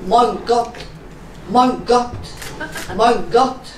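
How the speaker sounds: short, repeated German exclamations; restrained mock climax in German; German orgasm. Comedian parodying a German lover, understated mock climax. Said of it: "German orgasm".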